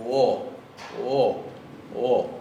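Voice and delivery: "O" begun with a little U glide; O with small U. Shown as a demonstration. Teacher showing students the unaccented Icelandic O, small U in front. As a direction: say "O" as a quick "uo". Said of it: "tiene una pequeña U adelante".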